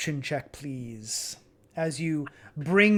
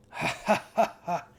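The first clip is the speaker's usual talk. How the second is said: dry knowing barkeep laugh; bartender chuckle. Acted. Bartender to the cold-boned dwarf player, mock chuckle.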